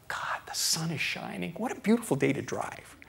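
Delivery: hushed, grateful appreciation; contented marvelling. Retold or imitated. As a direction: take in the lovely day under your breath. Voices contented driver enjoying day.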